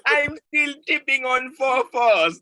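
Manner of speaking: high laughing quote. Pretend. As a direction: say it through laughter. Repeating the lyric laughing.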